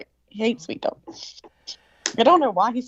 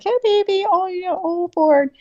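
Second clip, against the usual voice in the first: put-on much higher baby-talk voice for a dog; dog sweet talk; 12 semitones higher, 4 dB louder than usual. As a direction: coo at a pet like a baby. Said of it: "I'll do that sweet talk to 'em".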